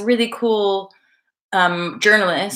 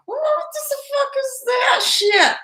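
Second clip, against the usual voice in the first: very high, drawn-out Scottish outburst; Scottish accent; slower than usual. Acted. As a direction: exclaim slowly in a high, joking Scottish accent. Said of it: "it's, like, quite good. I'm like".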